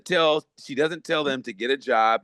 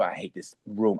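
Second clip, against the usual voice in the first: muttered; 7 semitones lower, 5 dB quieter than usual. Retold or imitated. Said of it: "I said".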